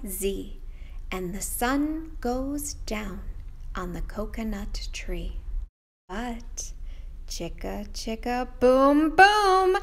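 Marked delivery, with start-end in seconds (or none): 3.7-8.5 whispered nighttime hush. Acted. Teacher hushing the kids as the sun sets. 8.6-9.8 higher, louder burst; spring up to the moon; excited reveal. Acted. Teacher surprising the kids with the full moon.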